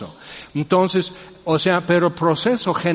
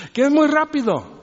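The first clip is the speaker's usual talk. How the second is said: mock complaint; 10 semitones higher than usual. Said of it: "la queja de cualquier gringo".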